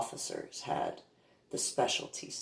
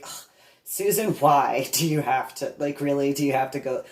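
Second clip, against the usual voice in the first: louder, groaning mock complaint; exasperated kid; 6 dB louder than usual. Kid to lecturing classmate on field trip, exasperated. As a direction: imitate a kid who's had enough.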